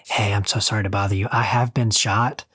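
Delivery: mock-polite understatement, as if nothing were wrong; overly polite patient. Politely reporting a gunshot wound.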